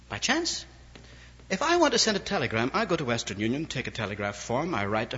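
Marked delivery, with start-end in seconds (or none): 0.1-0.6 "By chance" asked high with a scornful rise; mocking question. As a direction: scoff at the idea, pitch way up.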